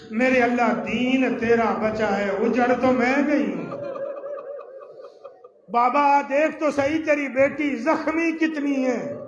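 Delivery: wounded daughter crying out; lamenting cry. Acted. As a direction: call out to God and father in grief. Zahra's lament voiced.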